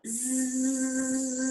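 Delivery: long, steady buzz on one breath; buzzing bee sound. Shown as a demonstration. Teacher leads breathing exercise, long bee buzz. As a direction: let a z buzz until the breath fades. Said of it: "make that buzzing bee sound out".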